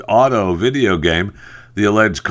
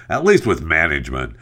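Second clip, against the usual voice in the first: ordinary talk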